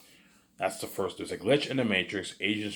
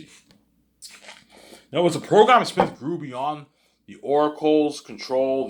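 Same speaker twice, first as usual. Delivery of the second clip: high, loud and slow, drawn out; emphatic; 8 semitones higher, 10 dB louder, much slower than usual. Real, not acted. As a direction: lay it out with big emphasis. Emphatic explanation.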